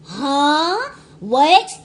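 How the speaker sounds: loud disbelieving huh, what; incredulous huh. Real, not acted. Reacting to the movie scene, loud shocked disbelief. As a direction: blurt it out in shock.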